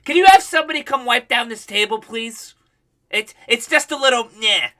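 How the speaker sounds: high, loud mock of a demanding customer; mock entitled mom. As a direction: play the entitled lady asking staff. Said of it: "She's like".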